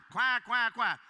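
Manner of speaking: loud, high mock quack, quack; imitated duck quacks. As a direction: quack like a duck floating past. Duck sounds in story.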